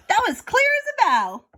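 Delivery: high, loud joyful cry; elated exclaim; delighted elephant. A narrator's reading. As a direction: announce it in triumph. Horton delighted.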